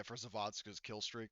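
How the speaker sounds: ordinary talk, nothing marked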